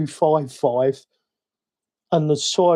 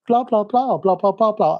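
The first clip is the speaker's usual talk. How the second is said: lightly mimicked blah-blah chatter, higher pitch; imitating Lisa; 5 semitones higher than usual. Lisa asking the smart speaker something, lightly mimicked. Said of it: "Lisa would go".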